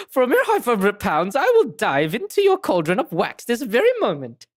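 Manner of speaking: grandiose sales pitch, swooping high; hammed-up detective. Detective offering to dive into wax, bombastically. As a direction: declaim it like a showy great detective.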